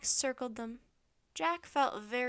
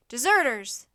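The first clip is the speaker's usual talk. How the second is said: centurion's forceful loud cry; shouted accusation; 13 dB louder than usual. A narrator's reading. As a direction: shout to raise the alarm. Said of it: "the centurion shouted again".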